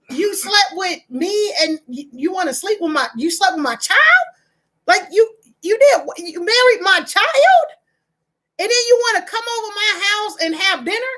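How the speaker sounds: hurt disbelief. Pretend. Confronting the child's partner.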